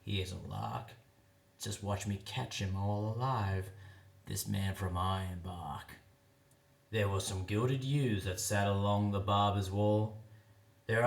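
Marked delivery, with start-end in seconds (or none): 0.0-6.0 softer, lower sly whisper; whispered aside. Acted. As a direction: hush it like a secret aside. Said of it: "he whispered".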